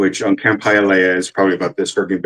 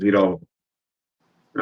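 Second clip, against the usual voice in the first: Uncle Reggie; 4 dB quieter, much slower than usual. Retold or imitated. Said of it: "Uncle Reggie says".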